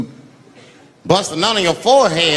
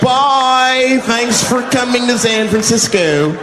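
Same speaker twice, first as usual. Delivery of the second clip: mock friendly farewell; 6 semitones higher, 5 dB louder, much slower than usual. Mock San Francisco farewell.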